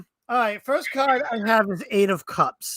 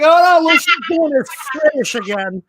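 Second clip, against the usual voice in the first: higher-pitched pretend alarm; lightly acted groaning complaint; mock dismay; 5 semitones higher, 3 dB louder than usual. Teasing co-host about the fake accent, mock dismay.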